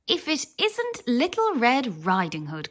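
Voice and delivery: a lightly voiced wizard crying out gleefully; cried out. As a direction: exclaim it like a cheery little wizard. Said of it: "cried Wizzo".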